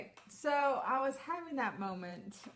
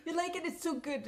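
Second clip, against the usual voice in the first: high, laughing, badly faked saleswoman accent; bad Italian-ish accent; 8 semitones higher, faster than usual. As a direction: push the brush in a mock Italian lilt. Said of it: "Now she's Italian".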